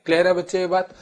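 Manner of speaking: normal talking voice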